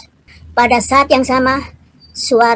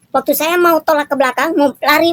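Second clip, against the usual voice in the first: usual speaking voice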